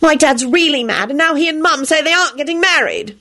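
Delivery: high accusing rant; angry blaming. A narrator's reading. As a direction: pile the blame on him. Rosie berating boyfriend on phone.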